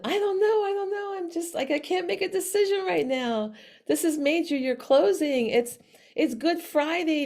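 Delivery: high-pitched anxious excuses; retold dithering, pitched well up; flustered protest. Retold flustered protest under pressure.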